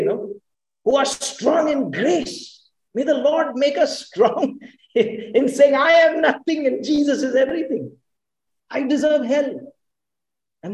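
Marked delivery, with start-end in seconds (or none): 0.8-9.7 fervent preaching. Real, not acted. Preacher rising to a climax.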